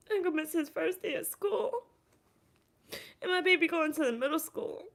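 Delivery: high, choked-up lament; tearful mother missing her son's day; near tears. Real, not acted. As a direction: voice catching on the edge of crying.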